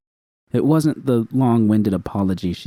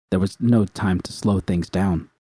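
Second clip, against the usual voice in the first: ordinary delivery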